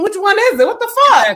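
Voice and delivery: high exasperated punchline; quoted grandmother, exasperated. Retold or imitated. Grandmother scolding the kids, high and exasperated. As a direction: throw up your hands, up high. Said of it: "She was like".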